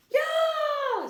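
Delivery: high, loud shriek; Leslie scream. A narrator's reading. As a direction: scream as your pigtail is yanked. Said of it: "screamed Leslie".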